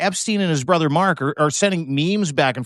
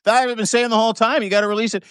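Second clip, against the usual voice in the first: higher, fast imitation of the president; mock Trump voice; 7 semitones higher, faster than usual. Podcaster imitating the president's backpedal, higher and hurried. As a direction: play him as a blustering hypocrite.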